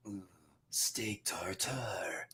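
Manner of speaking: low, slow, breathy seducer's whisper; Dracula seductive whisper. Retold or imitated. Dracula taking the heroine down, slow low whisper. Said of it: "Dracula to finally just be like".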